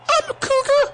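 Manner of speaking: squeaky-high mock boy soprano. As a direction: blurt it out in a tiny falsetto. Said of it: "with my s-, soprano voice".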